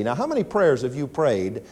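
ordinary talk, nothing marked

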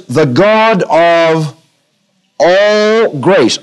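"all" drawn out long and slow; stress on all. Shown as a demonstration. Preacher reading a verse to the congregation, stress on "all". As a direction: underline "all" by stretching it. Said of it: "Underline the word all grace".

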